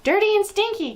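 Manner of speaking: high excited crowd echo; crying out. A narrator's reading. Audience of witches crying back, worked up. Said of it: "cried the audience".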